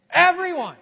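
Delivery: Everyone. called out high and loud; loud preacher's answer; emphatic everyone. Shown as a demonstration. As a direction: ring it out to the crowd. Answering his own question.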